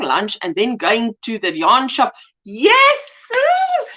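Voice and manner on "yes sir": high, drawn-out cry of approval; excited cheer. Real, not acted. Cheering a viewer's yarn shop trip.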